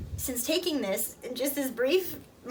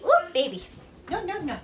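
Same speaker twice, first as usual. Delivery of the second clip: sudden higher-pitched no no no; 7 semitones higher, 3 dB louder than usual. Real, not acted. Mom calls out as her baby crawls to the cat box, sharply.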